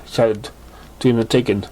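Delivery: ordinary delivery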